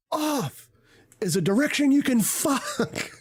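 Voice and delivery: high squeaky imitation of the text-to-speech; mock robot voice. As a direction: parrot the bot's line in a silly high voice. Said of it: "I've been waiting to do that".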